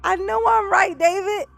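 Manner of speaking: loud, high, insistent; excited. Real, not acted. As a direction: crow that you were right. Insisting he's right to David.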